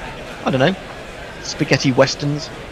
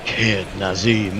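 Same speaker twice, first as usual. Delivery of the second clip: deep announcer voice; 6 semitones lower, 6 dB quieter, much slower than usual. Shown as a demonstration. Low slow nickname, jingle next.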